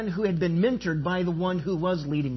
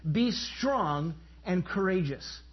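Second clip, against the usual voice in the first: slow, deliberate; 3 dB quieter, slower than usual. Shown as a demonstration. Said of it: "He says it three times".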